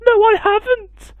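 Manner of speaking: very high, squeaky nervous goose. Acted. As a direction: quack it out nervously. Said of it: "quacked the goose nervously".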